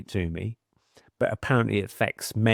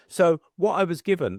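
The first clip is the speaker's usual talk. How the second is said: usual speaking voice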